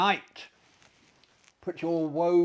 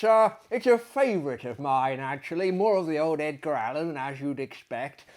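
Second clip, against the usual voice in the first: usual speaking voice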